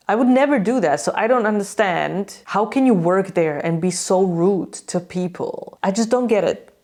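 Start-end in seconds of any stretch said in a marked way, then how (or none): none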